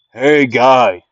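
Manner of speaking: loud, brash taunting jeer; a loud mocking shout at the villain; Bakugo voice. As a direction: heckle him like a cocky rival. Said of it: "Bakugo would say that".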